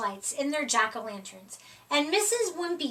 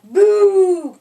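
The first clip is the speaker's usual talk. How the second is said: sudden louder boo, a bit higher; 5 semitones higher, 11 dB louder than usual. A narrator's reading. Second friend scaring the cat, loud boo. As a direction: shout boo to scare him. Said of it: "cried Plank".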